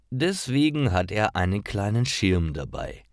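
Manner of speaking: normal talking voice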